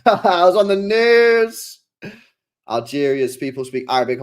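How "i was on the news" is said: laughing, proud boast. Real, not acted. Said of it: "And I was on the news".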